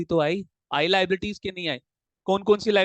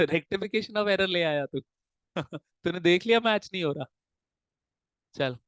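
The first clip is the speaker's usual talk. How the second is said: ordinary talk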